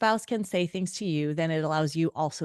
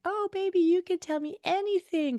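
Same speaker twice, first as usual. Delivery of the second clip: high cooing sweet talk; sweet girlfriend; 13 semitones higher than usual. Retold or imitated. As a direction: coo it like an eager girlfriend. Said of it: "And I'm like".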